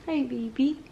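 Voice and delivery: quiet, gentle greeting. Real, not acted. Cooing to baby.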